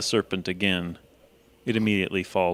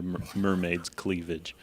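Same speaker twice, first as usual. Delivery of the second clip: normal talking voice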